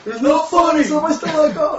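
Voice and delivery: high, loud mock whine; mock protest. Friends mock the wrestlers' protest in high loud whines. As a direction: ham up an offended whine.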